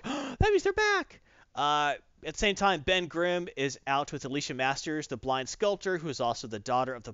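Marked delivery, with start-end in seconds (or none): 0.0-1.2 high, slow excited cry; thrilled realization, pitched way up. Retold or imitated. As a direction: gasp it out like a thrilled kid. Excited Torch quote.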